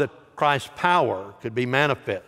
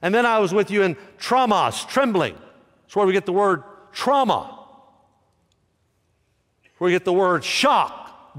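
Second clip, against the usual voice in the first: high, loud pulpit preaching over a list of words; 7 semitones higher, 4 dB louder than usual. Acted. Pastor preaching to his church, loud and high. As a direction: preach it out to the congregation. Preacher builds on Paul's fear.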